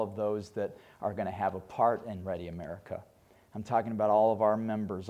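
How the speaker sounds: soft, low, earnest prayer voice; hushed pastoral prayer. Acted. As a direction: pray quietly and sincerely to close. Closing prayer.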